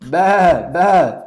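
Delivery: lion bleating like a sheep. Retold or imitated. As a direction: bleat like a lost lamb. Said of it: "what it used to say".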